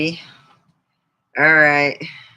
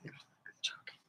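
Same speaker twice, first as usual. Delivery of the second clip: whisper; 23 dB quieter than usual. Real, not acted. Aside taking back a joke.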